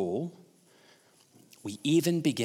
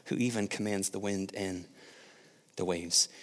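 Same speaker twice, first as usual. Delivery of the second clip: 1 awed hush; 5 semitones lower, 9 dB quieter than usual. Retold or imitated. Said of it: "they're like".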